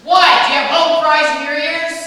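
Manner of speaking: loud exasperated yell; angry shout. Acted. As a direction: snap it out loud and irritated. Stephen yells at Angel.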